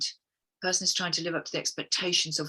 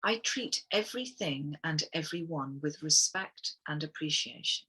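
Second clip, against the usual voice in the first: guided affirmation. Acted. Reads affirmations aloud to the group.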